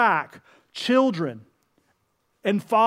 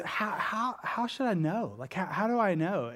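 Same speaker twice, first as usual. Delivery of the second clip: soft, hushed, hesitant questioning; quiet doubting; 10 dB quieter than usual. Retold or imitated. Preacher voicing Zechariah questioning the angel, hushed and doubting. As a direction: murmur it as a doubtful old man.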